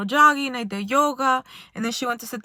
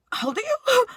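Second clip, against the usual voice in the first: much higher astonished question; incredulous; 12 semitones higher than usual. Retold or imitated. Asking the girl who told the daddy story, incredulous. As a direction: ask it in disbelief.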